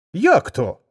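high, loud startled cry; wolf cries out. Acted. Wolf exclaims in disbelief at the lion, loud surprise. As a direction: gasp it out in shock. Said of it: "скрикнув вовк".

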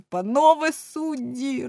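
high, slow, loud cry; trembling shout. A narrator's reading. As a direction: cry out shaking with pity. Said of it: "тремтів і кричав". (син кричить суддям: високо, повільно, гучно)

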